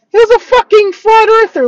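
incredulous, high-pitched outburst; loud disbelief. Real, not acted. Traveler revealing the driver's beliefs, loud disbelief.

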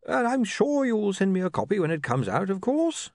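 dotty bursar. Acted. The bursar dictating his name to William, dotty and high-pitched. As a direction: spell it out in a high, deliberate voice.

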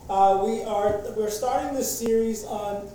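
normal talking voice

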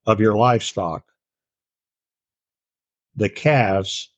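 measured, solemn reading of the verses; scripture reading. Acted. Teacher reading Deuteronomy's blessings aloud to his Bible class, solemn. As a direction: read the blessings with weight and pauses.

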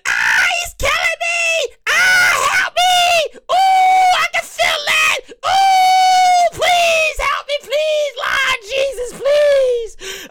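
very high, loud, slow shrieking pleas; screaming clam. Retold or imitated. Clam begging the chef for its life, high loud shrieks. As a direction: shriek for mercy like a clam being cracked. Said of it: "if the cram was screaming".

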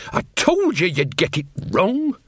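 high-pitched, vexed scolding; exasperated I-told-you-so. Acted. Mother telling her son he got it wrong, exasperated. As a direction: scold as if proven right.

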